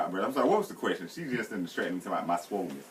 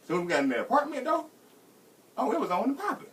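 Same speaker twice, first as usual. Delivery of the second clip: normal talking voice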